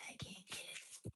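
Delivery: a breathless whisper for the dog. Pretend. Streamer whispers the dog's words while it hunts a moth.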